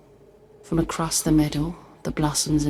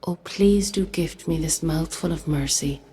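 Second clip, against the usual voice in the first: ordinary delivery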